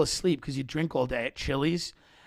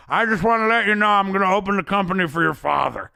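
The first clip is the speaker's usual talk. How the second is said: higher, louder earnest speech voice; mock eulogy; 6 semitones higher, 4 dB louder than usual. Boss promising a dead partner's son at the funeral, mock earnest. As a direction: ham up a sincere promise to the mourners. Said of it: "Bob then walks around at his funeral and goes".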